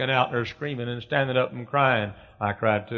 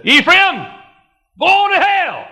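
high, full-force preacher's shout; thundering condemnation; 10 dB louder, slower than usual. Acted. The Lord condemning Ephraim, a thundering shout. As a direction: bellow the sentence as the Lord passing judgment.

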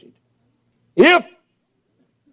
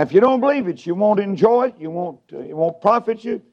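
ordinary delivery

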